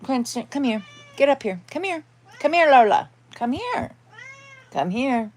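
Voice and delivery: high sing-song pet call; calling the dog. Real, not acted. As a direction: coax the dog over in a high, sweet voice.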